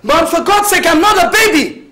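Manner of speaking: a grown son shouting at his controlling mother. Acted. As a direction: yell back at her mother in defiance. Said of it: "don't you dare shout at me".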